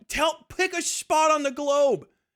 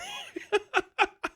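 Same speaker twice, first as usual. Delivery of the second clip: high laugh; 12 semitones higher, 5 dB quieter than usual. Real, not acted. Laughs at his own joke.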